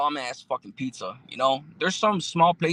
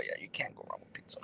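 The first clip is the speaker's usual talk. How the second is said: quick whispered aside, much softer; 17 dB quieter, faster than usual. Real, not acted. Streamer agreeing with a chat comment about pizza, whispered. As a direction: murmur it fast to yourself.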